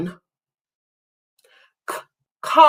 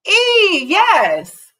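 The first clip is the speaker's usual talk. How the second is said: excited confirmation; 10 semitones higher than usual. Real, not acted. Confirming correct answer.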